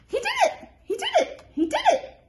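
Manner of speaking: very high, loud, laughing parrot squawk. Acted. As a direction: screech it like a cheeky parrot.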